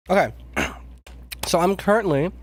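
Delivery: usual speaking voice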